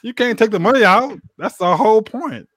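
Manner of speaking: high, insistent protest; heated protest. Real, not acted. Heated rule argument.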